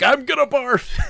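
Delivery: high-pitched comic wail of queasiness; seasick wail. Retold or imitated. Seasick player to fishing companion in storm, mock wailing. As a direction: whine it high, hammed-up, about to be sick. Retells panicking seasick on boat.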